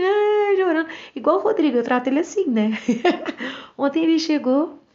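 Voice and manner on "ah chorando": mock whimper. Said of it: "Aí fica assim".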